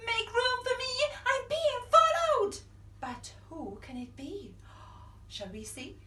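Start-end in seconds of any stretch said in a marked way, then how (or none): 0.1-2.6 high, loud hurried plea; excited. Acted. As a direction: squeal it out in a rush. Giraffe rushing in.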